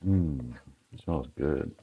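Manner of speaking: soft, low appreciative hum; savoring a smell. Real, not acted. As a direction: breathe in the aroma, pleased. Garlic just added to pan.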